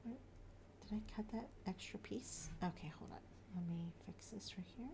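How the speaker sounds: soft unvoiced whisper to herself. Real, not acted. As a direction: whisper while concentrating. Concentrating mid-cut, hushed to herself.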